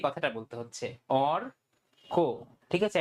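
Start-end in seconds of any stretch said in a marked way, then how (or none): 1.3-2.3 অর, then a pause, then কো; split in two. Shown as a demonstration. Teacher showing students where the word pauses, split in two. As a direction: break the word অরকো into two beats with a gap. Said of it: "এক জায়গায় কিন্তু pause হচ্ছে".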